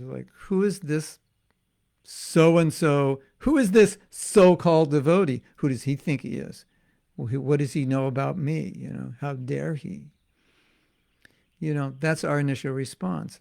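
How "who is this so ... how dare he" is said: mock-indignant outburst, loud at the start; indignant reaction. Devotee bristling at being criticized, indignant. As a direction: act out offended disbelief, easing off. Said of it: "Most of us don't feel like that when we're criticized".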